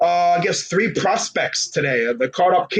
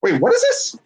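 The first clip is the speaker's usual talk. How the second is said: sudden high-pitched startled question; real surprise, much higher; surprised. Real, not acted. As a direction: caught off guard by a tweet.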